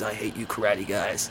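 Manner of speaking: low, dry, lightly voiced tough-guy line; a hint of Kurt Russell's cool deadpan; Kurt Russell quote. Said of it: "and he goes".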